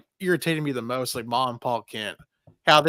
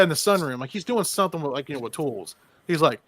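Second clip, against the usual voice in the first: ordinary talk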